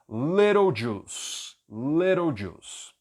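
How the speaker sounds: slow "Little juice", T flapped, "lero jus"; careful model. Shown as a demonstration. Teacher drilling "little juice" slowly for learners. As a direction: drawl "Little juice" with a tapped T. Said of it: "olha a pronúncia".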